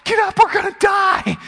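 high, loud panicked shout; frantic acted screaming; yelling captain. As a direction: yell to shake a sleeper awake in a storm. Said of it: "the captain's yelling at him".

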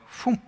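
short breathy phoom; mouth-made whoosh of being swallowed; quick puffed sound effect; imitated gulp. Imitates fish swallowing Jonah.

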